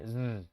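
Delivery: Z as a long, soft voiced buzz. Shown as a demonstration. Teacher models the z sound. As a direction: hum out the Z. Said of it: "el sonido va a ser también de la Z".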